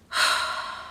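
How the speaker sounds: long, breathy sigh of frustration; exasperated sigh. Real, not acted. Vlogger venting about unordered food, exasperated sigh. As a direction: let out a heavy breath.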